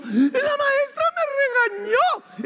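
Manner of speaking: put-on very high, slow crying child voice; high whimpered tattle; whining child. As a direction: act a kid tattling through fake tears. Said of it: "llega el niño".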